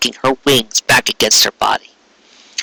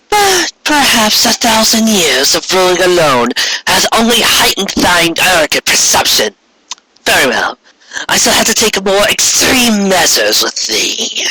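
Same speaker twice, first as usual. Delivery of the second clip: Corona commanding; 8 semitones higher than usual. Acted. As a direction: demand obedience from a sister. Corona threatens Luna.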